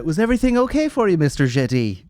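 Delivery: quick, lifted mock-polite Irish server; imitating Irish waitress. Waitress checking on Mister Jetty after dinner, lightly imitated Irish voice. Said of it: "she had an Irish accent".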